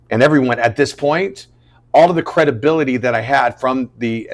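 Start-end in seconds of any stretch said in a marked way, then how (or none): none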